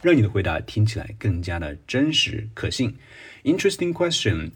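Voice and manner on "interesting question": high-pitched, musing "Interesting question"; buy time like you're pondering; stalling exam opener. Shown as a demonstration. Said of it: "有意思的问题，就说，嗯，这个挺难的啊". (故作思考地说)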